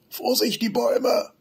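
very high frightened shriek; shrieking warning. A narrator's reading. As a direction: screech a warning at the trees. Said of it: "kreischt Benny". (kreischend, sehr hoch)

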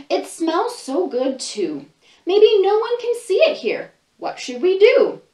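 put-on lively read-aloud for little kids, voices and all; storybook reading. Librarian reading a picture book to children, animated storytelling.

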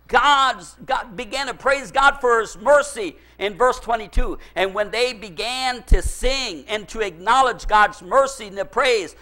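ordinary delivery

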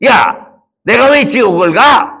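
indignant rich man. Acted. 부자가 화를 냄.

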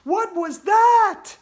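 very high, loud, drawn-out shriek; Andrea shouting. A narrator's reading. Andrea seeing a zombie on air, high shout. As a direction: shout it slowly at top pitch. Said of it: "Andrea shouted".

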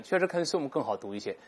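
ordinary talk, nothing marked

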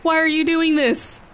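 high-pitched, exasperated protest; alarmed scolding. Real, not acted. Scolding a character for risky stunt.

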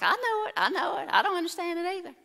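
high, fast whiny mock voice; hammed-up nervous Aaron. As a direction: squeak out a timid yes. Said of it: "Aaron's like".

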